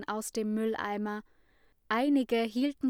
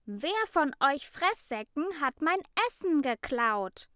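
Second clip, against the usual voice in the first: raised, higher voice, still furious; angry accusation; 6 semitones higher, 4 dB louder than usual. A narrator's reading. Ida accuses her greedy classmates, angry and raised. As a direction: accuse them all with rising anger.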